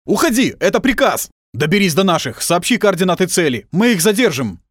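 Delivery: loud, high urgent orders; commanding shout. Acted. Lieutenant ordering the girl to run, shouting. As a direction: shout the order over gunfire.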